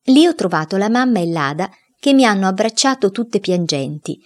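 usual speaking voice

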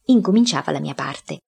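ordinary delivery

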